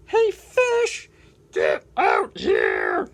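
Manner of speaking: whiny penguin, calls for fish high and loud; grumble, then yell for the fish; complaining penguin. Acted. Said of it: "Doing my complaining voice hurts".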